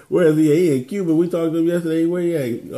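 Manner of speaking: bursting out laughing and asking; excited laughing. Real, not acted. Vlogger laughs loudly asking his partner where Smiley is.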